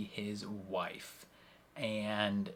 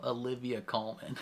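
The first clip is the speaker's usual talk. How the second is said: ordinary delivery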